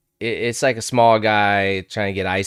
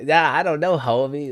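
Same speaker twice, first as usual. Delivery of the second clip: higher-pitched, casual imitation; Theo Von; 6 semitones higher than usual. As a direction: lightly mimic a laid-back comedian's "homie". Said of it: "he talks to Trump like that".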